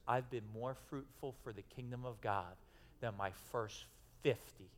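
C=95; retold tearful thanks. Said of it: "tears streaming down his face".

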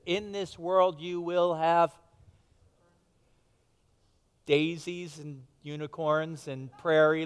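slow, sing-song mock-sweet version of the verse; mock misquote. Pastor jokingly misquoting Jesus to the congregation, slow and mock-sweet. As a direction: drag it out like a fairy tale promise, tongue in cheek. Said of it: "No, he said".

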